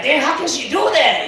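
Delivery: high, shocked disbelief. Retold or imitated. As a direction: react to the murder story in high disbelief. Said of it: "I was like".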